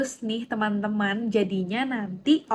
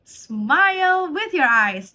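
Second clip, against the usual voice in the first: much higher, louder, very slow "Smile with your eyes"; beaming coach; 7 semitones higher, 6 dB louder, much slower than usual. Shown as a demonstration. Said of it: "facial expression". (pelatih ceria yang bersemangat)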